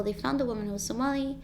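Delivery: ordinary delivery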